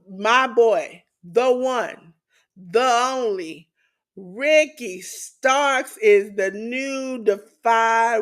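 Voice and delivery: slow, drawn-out champion introduction; announcer-style hype. Pretend. Host proclaiming his boy the new champion, drawn-out hype. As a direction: stretch each phrase like a ring announcer.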